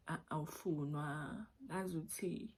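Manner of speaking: normal talking voice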